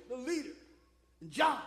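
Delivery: ordinary talk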